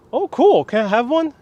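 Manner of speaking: high-pitched, eager request; acted monkey. Monkey asking the boy for a dumpling, high and eager. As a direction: pipe up high, lightly playing the monkey. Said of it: "he asks the monkey".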